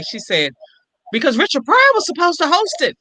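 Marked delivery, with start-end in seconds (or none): 1.1-3.0 excited news. Retold or imitated. Friend's excited news, retold.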